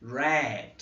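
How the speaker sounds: red said with an open E like é; teacher showing the E in red; English word demo. Shown as a demonstration. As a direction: model red with the é sound. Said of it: "E ele tem esse som também".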